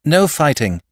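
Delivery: loud, firm order; soldier's command. A narrator's reading. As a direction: steel in the voice, take command. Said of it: "voice of steel of a soldier in command".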